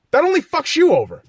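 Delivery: high, frustrated self-scolding; yelling at himself. Real, not acted. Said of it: "And I'm yelling at myself".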